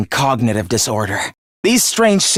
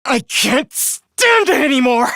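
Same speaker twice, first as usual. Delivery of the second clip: high, anguished shout; sudden high-pitched rage; exasperated outburst; 6 semitones higher than usual. Acted. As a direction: burst out, unable to bear it. Outburst ends the tirade.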